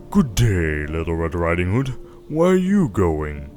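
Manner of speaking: sly wolf. Acted. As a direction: play the wolf coaxing the girl. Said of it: "So he came up to her very gently and said".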